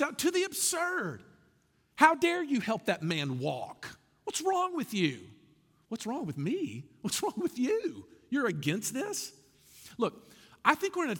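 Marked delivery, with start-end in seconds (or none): 1.9-9.3 hammed-up, incredulous indignation, drawn out; indignant outrage. Threatened critic berating someone for healing a man, mock indignant. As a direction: act out affronted objectors, pitch jumping up.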